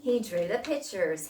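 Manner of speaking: normal talking voice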